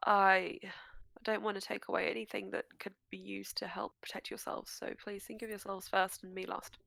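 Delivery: hushed, pleading whisper; whispered plea. Acted. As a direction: whisper it, begging them. GM voicing NPC plea, whispered.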